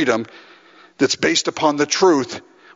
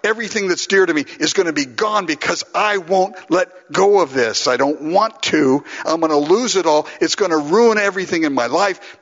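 urgent retold begging, pitch raised; pleading, begging; 2 semitones higher than usual. Preacher re-enacting his plea to be freed from drink, urgent begging. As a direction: pile up the pleas in one desperate run. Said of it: "pleading, begging".

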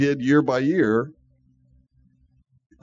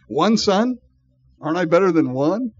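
raised, louder incredulous husband act; comic pleading, pitched up; mock bargaining; 5 semitones higher, 5 dB louder than usual. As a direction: imitate a husband haggling for approval. Husband's joking bargain for wife's approval.

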